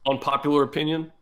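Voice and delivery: rising, playful call to the crowd; mock crowd appeal. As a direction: tease the audience with a hopeful lift.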